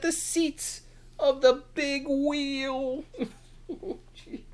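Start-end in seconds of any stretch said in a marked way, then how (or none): none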